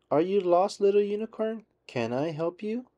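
high, gentle mouse voice; Minnie voice. A narrator's reading. Minnie gently asks lost unicorn, high voice. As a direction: ask kindly, high pitched. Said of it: "says Minnie".

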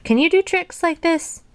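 high-pitched show-off question; boastful cry. A narrator's reading. Toad on the high wire challenges Frog, boastful cry. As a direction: crow to your friend in a high voice. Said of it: "cried Toad".

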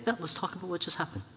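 gentle. Retold or imitated. Cuddling the boy, gentle talk.

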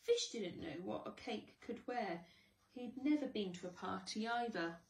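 ordinary delivery

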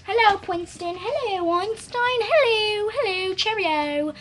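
high singsong hellos to the dogs. Real, not acted. Girl greeting her dogs in high baby talk.

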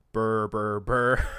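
loud spoken gunfire noise; mock gunfire burrs. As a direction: blurt out rattling burrs, loudly. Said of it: "reaction was just to say burr".

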